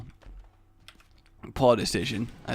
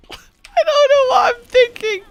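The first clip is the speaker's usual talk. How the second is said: very high, loud, squeaky with laughter; real cracking up, slowed by giggles; laughing through words; 9 dB louder, slower than usual. Real, not acted. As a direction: squeeze the words out while laughing. Cracking up at own story.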